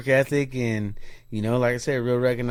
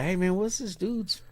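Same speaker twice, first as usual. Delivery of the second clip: hushed, higher-pitched aside; confidential question; 6 semitones higher, 7 dB quieter than usual. Retold or imitated. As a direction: imitate asking a coworker on the quiet. Retells asking coworker about Danny.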